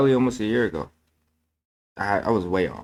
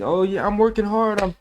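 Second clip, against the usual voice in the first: higher, mock self-satisfied brag; mock boastful self; 10 semitones higher than usual. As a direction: play your old proud self. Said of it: "that was me".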